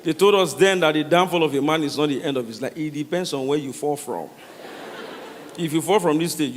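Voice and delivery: usual speaking voice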